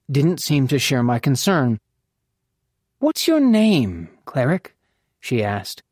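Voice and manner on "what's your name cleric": high, drawn-out question dropping at the end; curious. A narrator's reading. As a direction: ask with lilting, leisurely curiosity.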